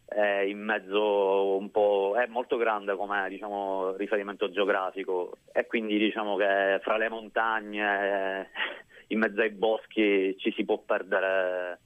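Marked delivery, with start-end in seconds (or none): none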